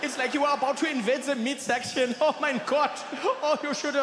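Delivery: put-on high, gleeful German-accented teasing; hammed-up amused German friend; laughing German friend. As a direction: tease through mock laughter with a German accent. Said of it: "He's like".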